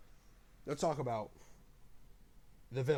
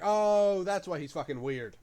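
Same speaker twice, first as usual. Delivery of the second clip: surprised realization; 5 semitones higher, 8 dB louder than usual. Retold or imitated. Viewer reacting to the twist.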